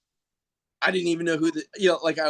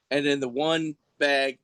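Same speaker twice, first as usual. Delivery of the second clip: normal talking voice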